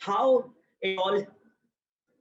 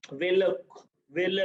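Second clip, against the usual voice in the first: ordinary delivery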